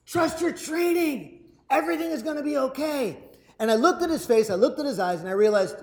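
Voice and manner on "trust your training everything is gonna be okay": calm him down from above; reassuring sergeant. Retold or imitated. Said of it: "he goes".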